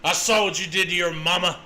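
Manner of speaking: fast, timbre-shifted mock threat; hammed-up stern father; stern Bob voice. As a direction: rattle off the threat like an angry dad. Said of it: "he's like".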